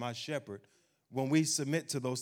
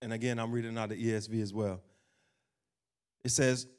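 ordinary talk

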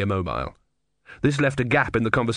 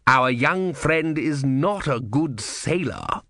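menacing inquisitor; 4 semitones higher, much slower than usual. Acted. Vorbis speaks slowly and smoothly.

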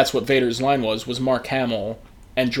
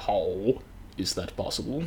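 low, slow mock Vader voice; a hint of Darth Vader, hushed and deep; lightly voiced Vader; Vader impression; 5 semitones lower, 10 dB quieter, slower than usual. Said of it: "And Vader's like".

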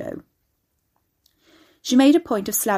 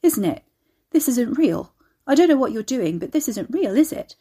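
shocked disbelief; 5 semitones higher, 5 dB louder than usual. A narrator's reading. Shocked Carrie protests, voice raised.

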